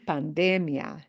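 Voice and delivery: pandemia as pan-DE-mia; pandemia, second-to-last syllable stressed. Shown as a demonstration. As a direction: punch the "de" in pandemia. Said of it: "punch the de".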